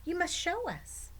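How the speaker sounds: put-on high, eager child demand; insistent boy. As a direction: press him like a pleading kid.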